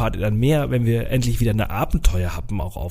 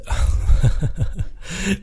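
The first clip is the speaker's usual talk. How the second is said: short laugh, out of breath; breathless laugh; 7 semitones higher than usual. Real, not acted. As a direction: laugh with barely any air. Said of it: "Ich krieg keine Luft".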